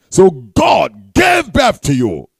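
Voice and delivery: forceful pulpit proclamation; preaching. Acted. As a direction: drive each phrase home to the congregation.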